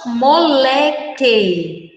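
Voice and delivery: "moleque" drawn out very slowly, syllable by syllable; "moleque" said at half speed for the class; slow model word. Shown as a demonstration. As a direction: stretch "moleque" so the que is heard.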